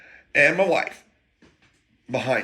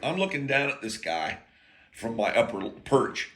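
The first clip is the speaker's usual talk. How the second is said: ordinary talk, nothing marked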